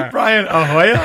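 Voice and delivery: high, laughing question; giggling at the silly name; laughing disbelief. Real, not acted. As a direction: say the name again, cracking up.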